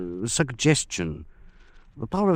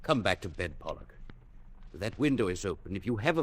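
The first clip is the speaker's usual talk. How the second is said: much softer, careful plea; hushed urging; 11 dB quieter, faster than usual. Acted. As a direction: coax him gently away. Urging him away from window.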